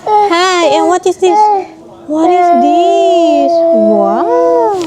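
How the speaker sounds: high-pitched coaxing parent voice. Real, not acted. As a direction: distract a crying little one with high, drawn-out wonder. Mother soothing her crying child.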